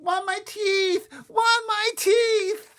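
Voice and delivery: very high, loud, drawn-out wail; toddler scream. A narrator's reading. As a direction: scream like a small child in a tantrum. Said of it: "Fudge started to scream".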